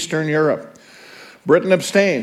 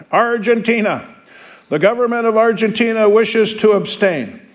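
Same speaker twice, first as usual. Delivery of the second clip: roll call announcer; 6 semitones higher than usual. Acted. Teacher reading the roll call to students, called out higher. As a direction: announce the country to the hall.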